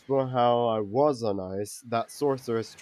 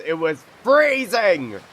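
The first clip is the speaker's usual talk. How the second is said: indignant exclamation; 7 semitones higher, 6 dB louder than usual. Acted. Complaining about being frozen.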